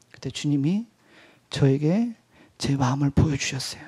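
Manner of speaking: normal talking voice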